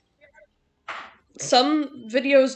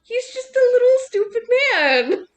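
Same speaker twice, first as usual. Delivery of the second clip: doting on the cat; 8 semitones higher, 8 dB louder than usual. Real, not acted. Defending the cat affectionately.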